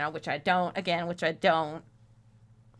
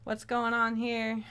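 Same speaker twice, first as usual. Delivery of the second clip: ordinary talk, nothing marked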